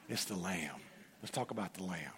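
drops to a low, soft hush; hushed, reverent. Real, not acted. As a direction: let it sink in quietly, like a secret worth keeping. Repeating his point about the Lamb.

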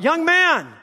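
high, loud yell across the gallery; shout. Retold or imitated. Old chess master yelling at the painted young man. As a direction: call out sharply to stop him. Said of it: "the old man yelled out".

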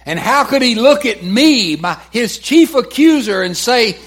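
loud, high, impassioned preaching; preaching outcry. Real, not acted. Preacher cries out to the congregation, loud and high. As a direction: cry it out to the congregation.

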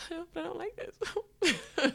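half-laughing, half-crying whine, drawn out slowly. Real, not acted. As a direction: wail it through real laughter, pitched high. Laughing complaint about her miserable job.

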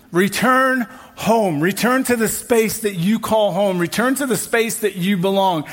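rising, insistent preaching over music; preaching litany. Acted. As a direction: drive each "Return" home to the room.

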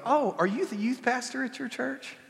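high, lightly voiced question; a hint of a doubtful church visitor; mock surprised stranger. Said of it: "People used to look at me and go".

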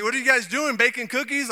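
mock curious stranger; lightly voiced shopper; nosy shoppers. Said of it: "People are like".

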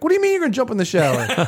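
high, fast exasperated disbelief; incredulous. Retold or imitated. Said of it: "I'm like".

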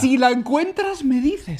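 put-on high, slow eerie old lady; mock-creepy old lady voice. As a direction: draw it out, eerie and sweet. Repeats the lady's line again.

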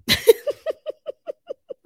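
high, trailing staccato laugh; giggling laugh. Real, not acted. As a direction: let a giggle run on. Laughs after repeating her point.

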